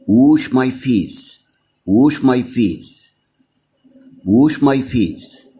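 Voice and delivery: very slow, every word clearly shaped; slow model phrase. Shown as a demonstration. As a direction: drag it out so kids can follow. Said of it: "Repeat after me". (ببطء شديد وبوضوح)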